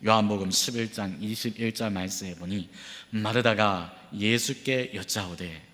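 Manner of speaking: ordinary talk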